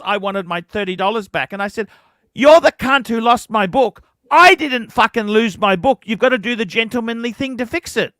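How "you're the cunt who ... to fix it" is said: loud, furious replay of telling him off; angry retold rant. Recalling telling off brother-in-law over the book, furious loud voice. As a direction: snap the insult back at him loud and hot.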